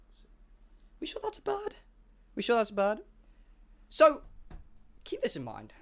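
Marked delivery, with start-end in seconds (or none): 0.1-1.8 breathless whispered question in the dark; hushed, frightened whisper; scared whisper. Retold or imitated. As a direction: whisper it like something's behind you.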